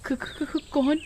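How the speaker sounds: put-on scared girl begging for her life; terrified, stuttering plea; stammering fear. Girl sees witch, stammers.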